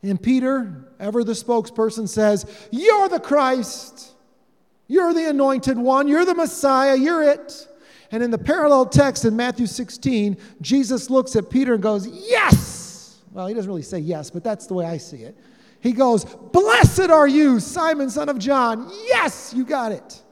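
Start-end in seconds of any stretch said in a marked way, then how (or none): 2.7-7.7 loud, high, excited declaration; emphatic proclamation. Retold or imitated. Peter's confession acted out. 12.1-13.2 high, triumphant burst; exultant cry. Retold or imitated. Jesus' delighted reply, as imagined. 16.2-20.2 loud, high burst easing down; jubilant praise. Retold or imitated. Jesus delighted with Peter's answer.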